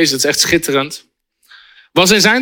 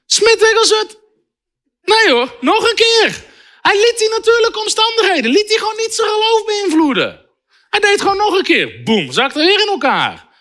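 high, fired-up preacher voice; fervent preaching; 13 semitones higher than usual. Acted. Preacher to congregation, fired-up fervent preaching. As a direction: preach it with rising fervor. Preacher retelling a miracle story.

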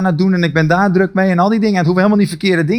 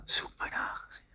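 soft breathy whisper, calling again and again; God calling in the heart, a hushed whisper; 23 dB quieter than usual. Acted. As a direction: whisper it like an inner call from God. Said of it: "Hij hoorde in zijn hart".

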